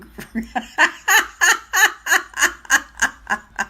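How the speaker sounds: long, loud, high laughing fit; real belly laugh. Real, not acted. Amused by her sister's comments, real loud laughter. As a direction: burst out in a rolling cackle.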